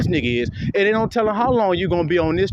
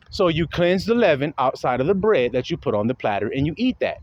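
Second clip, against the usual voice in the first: ordinary talk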